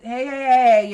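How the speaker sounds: mock an over-eager greeting; overdone greeting. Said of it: "one, I ain't".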